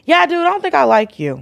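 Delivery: high-pitched, exaggerated brush-off; retold blunt rejection. Retelling her reply to a friend's rude date, high-pitched dismissal. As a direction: tell him off with a lifted, mock-casual voice.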